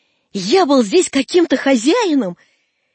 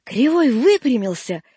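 plain reading voice

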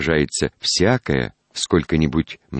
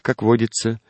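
usual speaking voice

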